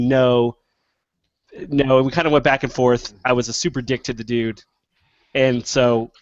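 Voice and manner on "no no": ordinary delivery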